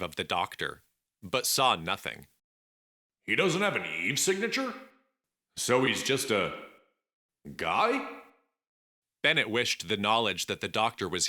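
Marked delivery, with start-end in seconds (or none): 3.3-8.2 higher, puzzled questioning; puzzled young man. Acted. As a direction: wonder it aloud, baffled. Bennett puzzled about the doctor.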